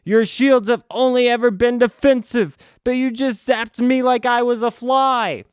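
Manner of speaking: silly high voice reading cheesy lines; mocking comic dialogue. Reviewer mocking the comic's fight dialogue, high hammy voice. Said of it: "You want some dialogue?".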